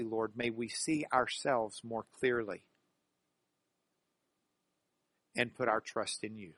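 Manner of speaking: low, soft prayer with long pauses; prayer of blessing. Real, not acted. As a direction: bless them quietly, phrase by phrase. Prays a blessing over the congregation.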